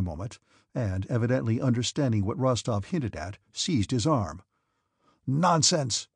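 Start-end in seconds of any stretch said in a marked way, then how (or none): 5.3-6.1 higher-pitched, mildly raised outburst; indignant cry. A narrator's reading. Officer rejects the hinted accusation, indignant cry. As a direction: cry out in sharp denial. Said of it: "he cried".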